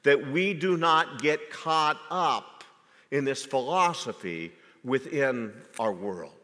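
ordinary delivery

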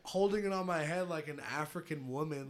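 normal talking voice

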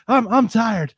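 high-pitched worn-out complaint, hammed up; Jackie Moon impression. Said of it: "Will Ferrell, Jackie Moon, he's just".

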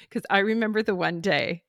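normal talking voice

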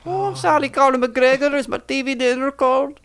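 Fat Bastard. Pretend. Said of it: "I feel like Fat Bastard".

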